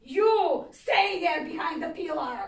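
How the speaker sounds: loud, slow barked order; angry border officer yelling; officer shouting. Retold or imitated. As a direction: command it like a stern officer. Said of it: "the officer shouts at him".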